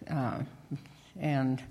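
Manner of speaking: ordinary talk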